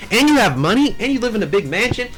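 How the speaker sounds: high, impressed gold-digger act; hammed-up swooning come-on; mock flirting. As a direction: play up being impressed to flirt. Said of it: "she's trying to spit game".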